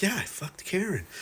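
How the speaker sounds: lightly voiced jock boasting; mock bragging Rick. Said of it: "And Rick's like".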